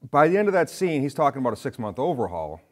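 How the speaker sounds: usual speaking voice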